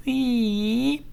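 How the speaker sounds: high squeaky creak; gate squeak. A narrator's reading. Hippo nudges the castle gate open, high creaky squeak. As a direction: squeak it like an old wooden gate. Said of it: "making a squeak".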